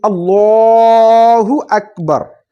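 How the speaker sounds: chanted takbir, held long; drawn-out takbir. Shown as a demonstration. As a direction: call it out long like the imam. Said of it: "takbir demo".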